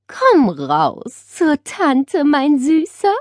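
high, very slow sweet coaxing. A narrator's reading. Aunt to the parrot under the blanket, coaxing sweetly. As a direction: lure gently, drawing it out. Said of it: "lockte sie".

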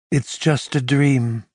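calm him gently like a lover; a woman comforting her partner after his nightmare, gently; gently murmured. A narrator's reading. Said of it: "she murmured gently".

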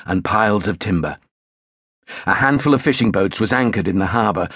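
plain reading voice

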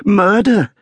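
high, loud, horrified exclamation; agitated servant's cry; shaken butler. A narrator's reading. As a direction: burst out with the dreadful news. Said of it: "propriety shaken out of him".

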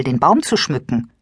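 plain reading voice

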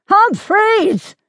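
high, drawn-out battlefield shout; bellow. A narrator's reading. Gabriel shouts to warn his lieutenant on the battlefield, bellowing. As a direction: bellow the name across a battlefield. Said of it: "he bellowed again".

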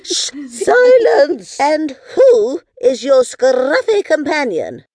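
high, loud, imperious queen; Queen Moo. Acted. Moody queen demanding who the dog is, loud and imperious. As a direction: command in a drawn-out, moody royal voice. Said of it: "cried the moody queen".

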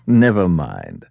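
low, slow chuckled reassurance; amused detective, slow and low; laughing. Acted. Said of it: "said Holmes, laughing".

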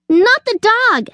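high squirrel yelp; panicked yell. A narrator's reading. Squirrel stopping Sugar fetching the dog, yelling. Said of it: "yelled the squirrel".

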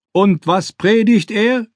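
slow, high shout over the noise; shouting back. Acted. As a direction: shout the question across a crowd. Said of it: "schrie Rechberg zurück".

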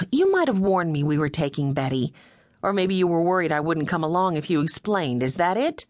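usual speaking voice

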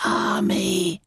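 high, sighed bird voice; sighing caterbird. Acted. Caterbird over the crushed clodder-trog, high sigh. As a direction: let out a high creature sigh. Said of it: "he heard the caterbird sigh".

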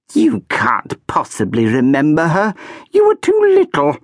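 high, dismissive, shrill rise; haughty archduchess. A narrator's reading. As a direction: brush the child off.

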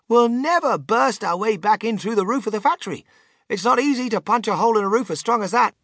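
high, eager explanation; emphatic inventor. A narrator's reading. Mister Wonka explaining the crash plan to the boy, high and eager. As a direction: explain it with zany confidence.

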